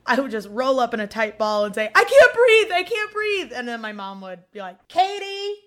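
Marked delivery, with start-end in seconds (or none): 1.9-3.5 high, wailing fake distress; mock panicked cry. Re-enacting childhood panic before sister attacks. 4.9-5.5 high imitation of a scolding mother; mom calling out.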